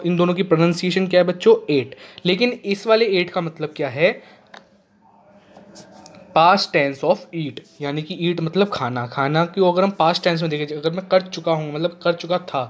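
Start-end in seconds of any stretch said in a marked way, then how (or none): 6.4-7.6 extremely slow, word by word; slow dictation. Shown as a demonstration. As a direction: stretch every word for copying. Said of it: "teacher dictating a definition". (बहुत धीरे लिखवाना)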